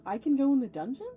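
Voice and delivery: ordinary delivery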